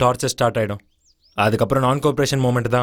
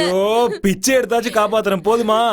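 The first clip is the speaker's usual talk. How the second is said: exasperated; 7 semitones higher than usual. Acted. Exasperated reply to girlfriend.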